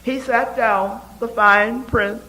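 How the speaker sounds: usual speaking voice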